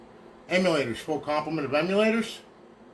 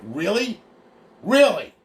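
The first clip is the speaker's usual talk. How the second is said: higher, rising then loud falling disbelief; scornfully incredulous, louder on the repeat; mock-incredulous; 6 semitones higher, 7 dB louder, slower than usual. Real, not acted. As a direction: challenge the smartass in disbelief. Scornful reply to a smartass accusation.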